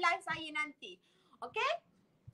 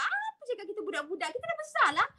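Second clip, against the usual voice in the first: fast, high mock indignation; hammed-up huffy retort; indignant protest; 6 semitones higher, faster than usual. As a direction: protest like a yellow person offended at being called childish.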